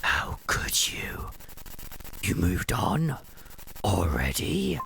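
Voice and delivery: bitter accusing whisper from a ghost; ghostly whisper. Acted. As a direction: whisper the betrayal coldly.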